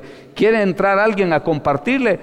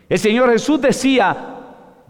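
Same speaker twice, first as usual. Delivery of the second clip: ordinary delivery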